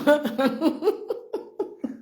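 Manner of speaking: high breathy laugh. Real, not acted. Mocking the people of Le Havre, high laugh. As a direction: let a giggle run up.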